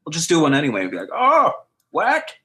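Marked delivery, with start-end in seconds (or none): 0.0-0.8 pushy director voice. Retold or imitated. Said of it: "And they said". 1.1-2.3 put-on high, creaky old man; wobbly elderly croak. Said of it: "Do an old man".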